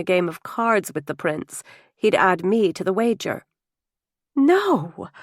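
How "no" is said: high, loud horrified "No!"; sharp shocked outburst; horrified cry. A narrator's reading. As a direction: cry out in horror. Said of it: "I cried, horrified".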